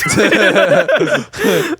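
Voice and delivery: loud squealing laugh; laughing at the awkward moment; high burst of laughter. Real, not acted. Laughing while retelling apology.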